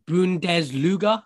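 "Bundesliga" drawn out slowly with a put-on German sound; mock German pronunciation. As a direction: sound out "Bundesliga" as if it's a foreign, unknown word. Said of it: "I don't know if I'm pronouncing that correctly".

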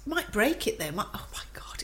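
hushed whisper. Real, not acted. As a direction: whisper it under your breath. Worried about breaking chair.

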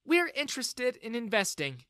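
lightly voiced eager investor; female character voice.

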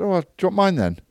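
high, sly mock-innocent offer. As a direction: tease him with a sweet high voice. Own retold offer, high.

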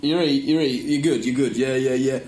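put-on Stoke accent; imitating a northern mate's small talk. Stoke fan greeting a guy at a wedding, northern accent. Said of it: "Craig's accent".

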